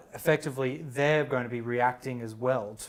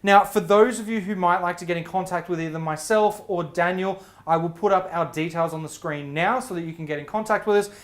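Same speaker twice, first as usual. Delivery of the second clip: higher, louder programme outro; presenter sign-off; 4 semitones higher, 5 dB louder than usual. Acted. Host thanking guest and closing the episode, projected presenter sign-off.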